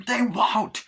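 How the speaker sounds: high, loud retort; arguing daughter. Retold or imitated. Carrie argues back at her mother, loud protest. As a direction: shout back in a mock argument. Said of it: "when her and the mother were arguing".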